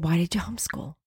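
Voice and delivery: whispered, insistent question; very quiet. Retold or imitated. God not letting her get away with it, whispering. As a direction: whisper it like a still small voice. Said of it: "very quietly in my spirit".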